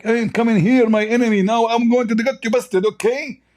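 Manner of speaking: high mocking taunt of the opponent; mocking imitation. Mocking the debater who invites his enemy in, high taunting voice. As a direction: sneer it up high like a smug rival. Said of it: "he was like".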